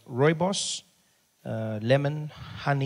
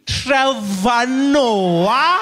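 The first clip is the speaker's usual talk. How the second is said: very slow, high, loud drawn-out question; receptionist recognising name; 10 semitones higher, 10 dB louder, much slower than usual. Retold or imitated. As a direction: stretch the name in mounting recognition. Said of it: "She's like".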